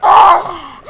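very loud, sudden cry; startled yell. Real, not acted. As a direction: yell out as the enemy appears. Runs into an enemy in game.

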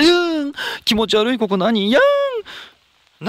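high, loud squealing shriek of disgust. Real, not acted. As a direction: squeal it out like stepping on something gross. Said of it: "笑ってるようにしか聞こえない".

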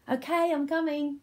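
high-pitched call to another room. Pretend. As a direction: answer the waiting children from afar. Calls out to waiting children.

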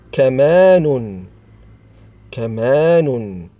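slow, drawn-out dictation of a word; slow enunciation. Shown as a demonstration. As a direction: stretch each syllable for young learners. Teacher sounds out example word. (نطق بطيء ممدود للتعليم)

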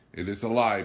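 high, loud cry of denial. A narrator's reading. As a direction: cry out the accusation loudly. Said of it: "Pilon cried about".

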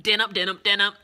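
punchline shout. Shown as a demonstration. Telling her auntie joke to viewers, loud punchline. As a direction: hammer the punchline home.

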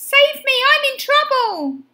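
high, loud cry for rescue; urgent plea. A narrator's reading. Benny begs the boys to save him, high urgent plea. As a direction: plead urgently, pitched right up. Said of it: "he gasped".

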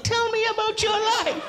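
very high, sugary earnest plea; hammed-up tender voice; gushing. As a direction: overplay the tender moment. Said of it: "And I'd be like".

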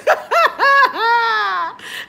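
high, loud shrieking laugh; real loud laugh; laughter. Real, not acted. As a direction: lose it completely. Laughing hard.

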